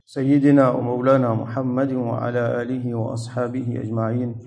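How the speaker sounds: low, rapid Arabic recitation; formal opening invocation. Real, not acted. Preacher opening a religious lesson with praise and greeting, formal recitation. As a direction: recite the blessings quickly in a subdued, low voice.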